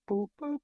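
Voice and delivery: high, soft electronic boop sounds; Pong beeps. Retold or imitated. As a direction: beep like an old arcade game. Imitating Pong game beeps.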